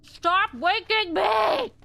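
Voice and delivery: angry shout. Acted. Woken panda yells at player.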